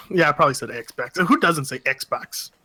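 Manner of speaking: ordinary talk, nothing marked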